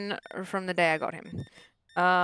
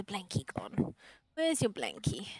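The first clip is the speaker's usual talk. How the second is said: whisper; 5 dB quieter, slower than usual. Real, not acted. Whispering to dog.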